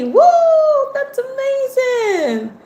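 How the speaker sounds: loud, high-pitched whoop of delight; whoop, then stretch the praise out high; elated, drawn-out celebration; excited cheer. Real, not acted. Cheering viewer's news.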